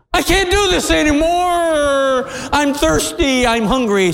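put-on high, stretched child's wail easing down; whining little girl. Little girl fed up with walking, whining. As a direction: start very high and drawn out, then let it sink. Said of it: "when she said".

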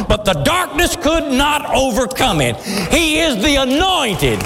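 high, rising preacher's cry building to a shout; preaching at full force. Real, not acted. Preacher exalting Christ to the congregation, building to a shout.

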